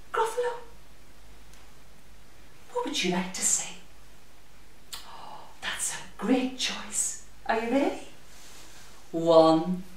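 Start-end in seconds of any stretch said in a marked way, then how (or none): 2.7-7.2 hushed aside. Acted. Listening to the puppet's answer.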